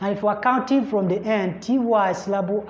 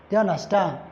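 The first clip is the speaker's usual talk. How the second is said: usual speaking voice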